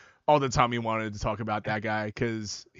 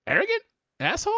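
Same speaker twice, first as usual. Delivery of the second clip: surprised. Retold or imitated. Incredulous quote.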